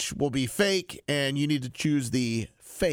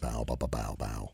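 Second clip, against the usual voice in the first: deep voice; 8 dB quieter than usual. Pretend. Drawn-out stammer on bowels.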